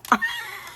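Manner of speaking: very high surprised squeal of a laugh. Real, not acted. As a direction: let a shriek of laughter escape. Surprised by magnets.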